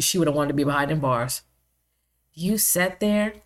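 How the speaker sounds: ordinary delivery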